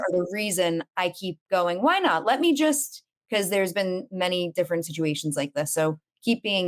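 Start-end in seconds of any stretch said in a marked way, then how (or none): 1.8-3.0 eager inner voice. Retold or imitated. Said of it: "retold inner voice".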